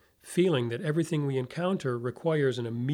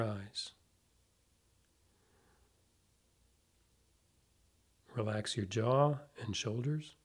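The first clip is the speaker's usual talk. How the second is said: guided meditation; 4 dB quieter than usual. Acted. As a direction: ease them into calm, softly, with long pauses.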